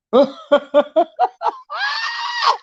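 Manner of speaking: very high squealing laugh; long shrieking burst of laughter. Real, not acted. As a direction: crack up at a joke. Laughing at bulldog joke.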